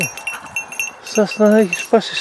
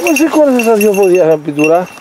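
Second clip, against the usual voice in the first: loud, exasperated yell at the dog; loud exasperated call; 8 dB louder than usual. Real, not acted. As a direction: shout in mock scolding at the hound. Shouting at the dog.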